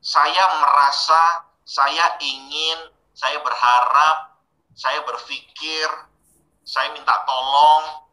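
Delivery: each saya phrase drawn out very slowly; slow deliberate examples. Shown as a demonstration. Trainer slowly modelling I-statements for the class. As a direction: spell out every saya merasa, saya ingin slowly, like a model. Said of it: "gunakanlah depannya pakai saya".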